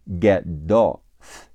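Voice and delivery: "Get off" split slowly as "geh - doff"; drawn-out "geh... doff" for "Get off"; slow linking demo. Shown as a demonstration. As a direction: turn the t of "Get" into d and carry it onto "off". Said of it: "get的t会变成的".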